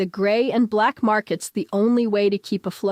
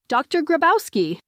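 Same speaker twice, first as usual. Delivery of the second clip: higher call across the foyer; 7 semitones higher than usual. Acted. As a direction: call her name to catch her. Manager calling out her name.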